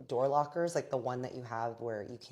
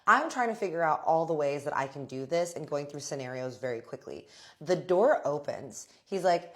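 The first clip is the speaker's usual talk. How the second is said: ordinary delivery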